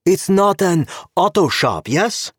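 put-on high, accented official voice; a stern Czech cop with broken English; Czech policeman. Czech cop stopping Gabe at the car, politely insistent.

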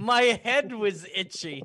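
loud, drawn-out mock repeat; mocking imitation. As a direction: mimic the excuse slowly and loudly, teasing. Mocking repeat of cohost's excuse.

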